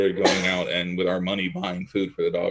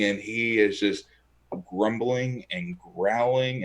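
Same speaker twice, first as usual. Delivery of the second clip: ordinary talk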